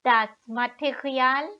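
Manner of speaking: "Das Material" the German way, ma-te-ri-AAL; German pronunciation. Shown as a demonstration. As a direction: say "Material" as German, stress on the last syllable. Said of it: "in the German way".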